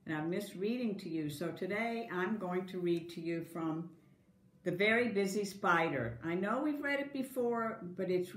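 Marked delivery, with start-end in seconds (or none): none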